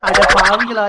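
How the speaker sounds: loud indignant outcry; loud mock scolding. Bride berates friends back from the feast, loud scolding. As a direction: burst out loudly in reproach.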